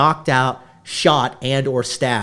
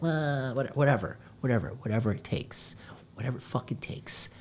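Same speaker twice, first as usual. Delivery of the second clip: fading to a whisper; 2 semitones lower, 12 dB quieter than usual. Real, not acted. Trailing into a muttered aside.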